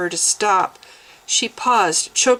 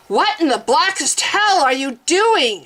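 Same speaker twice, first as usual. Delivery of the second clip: blurting; 8 semitones higher, 3 dB louder than usual. A narrator's reading. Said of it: "she blurted".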